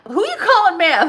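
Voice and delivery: high, loud indignant comeback; who you calling ma'am, said mock indignant; mock offense. Real, not acted. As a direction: act playfully insulted. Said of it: "offended aside about ma'am".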